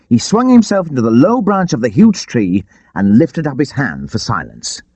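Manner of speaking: usual speaking voice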